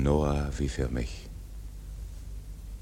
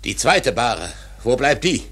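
louder, higher demand; rising anger; 6 semitones higher, 4 dB louder than usual. Acted. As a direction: fling the question at fate. Ahab raging at the prophecy.

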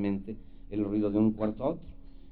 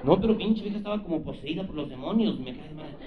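ordinary talk, nothing marked

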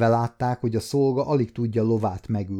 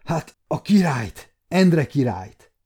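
higher and louder, blurted out; agitated; 3 semitones higher, 4 dB louder than usual. A narrator's reading. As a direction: gasp out the terrible news. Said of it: "mondta a szolga izgatottan".

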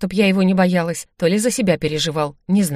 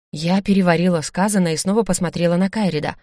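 plain reading voice

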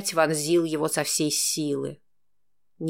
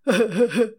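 coarse laugh; 4 semitones higher, 3 dB louder than usual. A narrator's reading. Jailers laughing.

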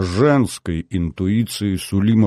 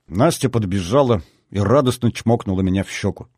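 plain reading voice

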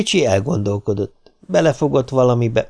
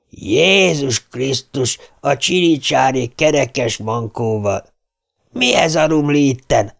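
slow, dragged-out rasping voice; raspy old man; 4 semitones higher, slower than usual. Acted. Mr. Neil scolds the boys in a slow, raspy old voice. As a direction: bark it out like a rough old drunk. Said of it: "reszelős hangja".

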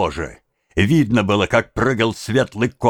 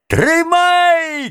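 very high, long cry; piercingly high answering call; thin tenor shout; 4 dB louder than usual. A narrator's reading. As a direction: yell back in a thin tenor. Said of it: "кричал в ответ первому второй тонкий тенор".

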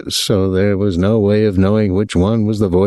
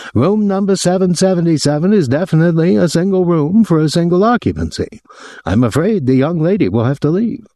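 high-pitched, aggrieved hotel official; aggrieved man; 10 semitones higher than usual. A narrator's reading. Hotel man phoning a guest about his visitor, aggrieved. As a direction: put on a high, officious voice of complaint.

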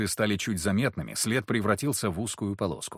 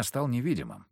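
ordinary delivery

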